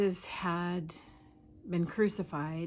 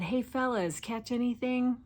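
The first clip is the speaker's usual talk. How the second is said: higher-pitched friendly hail; calling out; 6 semitones higher than usual. Retold or imitated. Jesus hailing the disciples from the shore, calling out. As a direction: call over to them, pitched up.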